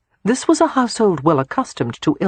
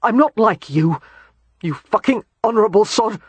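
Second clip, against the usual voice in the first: bitter outburst; 5 semitones higher than usual. Acted.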